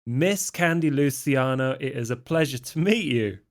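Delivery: lightly voiced London accent, charming; British accent. [name] greets Candy with a light British accent. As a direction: [name] plays the smooth Londoner. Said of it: "You're, like, what? British?".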